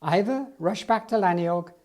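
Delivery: usual speaking voice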